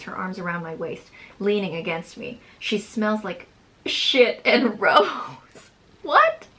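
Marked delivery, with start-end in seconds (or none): 3.9-5.1 slow, breaking into laughter; laughing. Real, not acted. Laughs mid-reading. 6.0-6.5 very high, loud disbelieving squeal; shocked. Real, not acted. Shocked at own writing.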